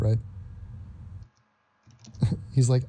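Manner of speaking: ordinary delivery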